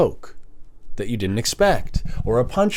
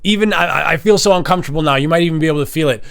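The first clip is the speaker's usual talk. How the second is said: ordinary talk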